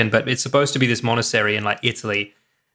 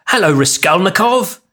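put-on British-accented greeting, raised and drawn out; 5 semitones higher, 4 dB louder than usual. Chernobyl actor greeting a Russian comrade, in a British accent. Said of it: "everyone's fucking British".

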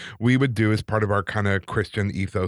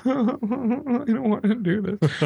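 high, slow, squeaky dread; mock-terrified whimper of not wanting to go; scared inner voice; 12 semitones higher, slower than usual. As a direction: plead to get out of it, high and drawn out. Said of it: "and I was like".

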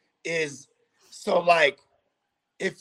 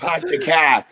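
laughing "Park the car" with no R; louder laughing Boston impression; Boston accent; 2 semitones lower, 5 dB louder, slower than usual. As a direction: echo the Boston pahk the cah. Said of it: "He said".

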